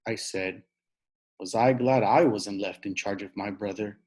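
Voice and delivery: ordinary delivery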